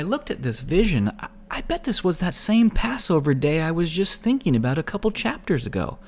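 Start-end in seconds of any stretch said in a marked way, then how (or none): none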